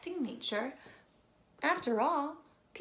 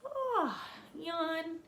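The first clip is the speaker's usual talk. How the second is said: bored; 6 semitones higher than usual. Acted. Bored child sighs at nature.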